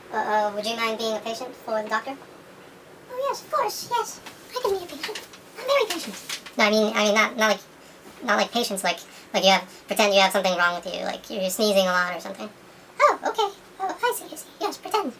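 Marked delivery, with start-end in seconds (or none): 3.1-6.4 much higher squeaky little cat voice; a sweet toy cat, eager to help, pitched way up; Mister Cat. Pretend. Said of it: "Mister Cat". 13.0-15.1 high squeaky cat voice catching on; the toy cat, pitched way up, suddenly understanding; Mister Cat. Pretend. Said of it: "Mister Cat".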